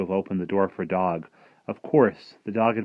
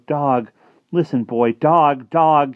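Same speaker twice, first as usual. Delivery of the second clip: higher, louder repeated calls; calling over and over; 7 semitones higher, 9 dB louder, slower than usual. Acted. Martin leans out the window calling his dog, loud and high. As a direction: call out to the dog again and again. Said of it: "he said it over and over".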